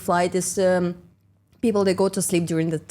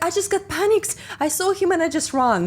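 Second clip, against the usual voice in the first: panicky colleague; 9 semitones higher than usual. Retold or imitated. Colleague explains her panic.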